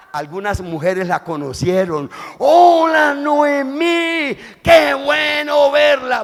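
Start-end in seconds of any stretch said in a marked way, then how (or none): 2.4-6.2 high, loud, slow excited greeting; women greeting. Acted. Village women welcoming Noemí back, high excited calls. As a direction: call out a delighted welcome.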